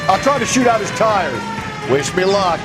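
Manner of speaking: shouting mid-chase. Acted. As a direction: call it out over the car chase. Shouting during a car chase.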